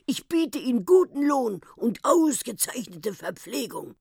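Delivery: wheedling professor. Acted. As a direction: sells his deal with relish. Professor voiced in story. (lockender Professor)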